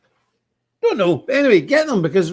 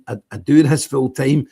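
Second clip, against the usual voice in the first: ordinary talk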